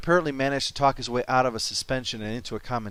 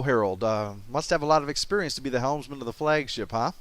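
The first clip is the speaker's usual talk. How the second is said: usual speaking voice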